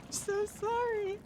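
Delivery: high, drawn-out apology between laughs; slow, laugh-strained voice; helpless apology. Real, not acted. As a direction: squeeze it out mid laughing fit.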